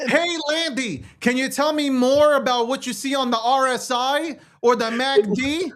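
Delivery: mock viewer. Host posing as someone asking Landy about charts, mock viewer. Acting out a viewer's market question.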